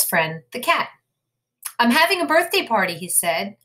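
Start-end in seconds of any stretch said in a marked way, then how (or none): 1.8-3.0 excited invitation. Acted. Rabbit inviting the cat.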